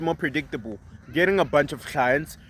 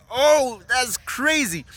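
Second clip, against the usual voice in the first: imitating amazed mom; 7 semitones higher, much slower than usual. Mom voiced in story.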